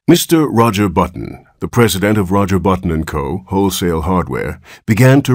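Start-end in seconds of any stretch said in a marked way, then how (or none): none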